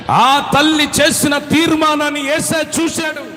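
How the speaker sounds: loud, high proclamation; proclaiming. Real, not acted. Preacher proclaiming God's answer, loud. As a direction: declare it with fire.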